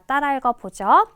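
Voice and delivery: usual speaking voice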